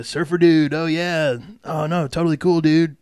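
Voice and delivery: laid-back Californian surfer dude accent. Shown as a demonstration. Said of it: "Sort of accent out in the West".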